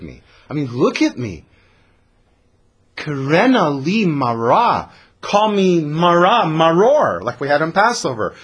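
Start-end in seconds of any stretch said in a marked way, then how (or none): 3.0-8.3 higher, louder and slow, biting each word; sharp, bitter. Acted. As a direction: spit out her new name bitterly. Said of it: "Naomi is a little sharp".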